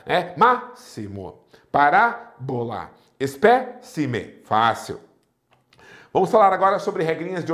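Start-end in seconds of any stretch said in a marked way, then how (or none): 0.4-4.4 "Máximo, parábola, espécime" slowly, third-last syllable stressed; stress on antepenultimate syllable. Shown as a demonstration. Teacher demonstrating proparoxytones, antepenultimate syllable stressed. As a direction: pause after the stressed syllable, then two lighter ones, in "Máximo, parábola, espécime". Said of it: "duas sílabas depois da tônica".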